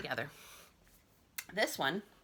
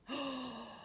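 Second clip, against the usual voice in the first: startled gasp; 7 semitones higher, 8 dB quieter than usual. Acted. Slams into principal.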